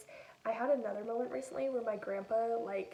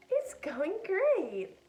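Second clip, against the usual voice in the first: much higher, very slow forced brightness; fake cheer; 8 semitones higher, 6 dB louder, much slower than usual. A grandchild faking a choir update to grandpa, much higher and very slow. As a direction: pretend it's all wonderful.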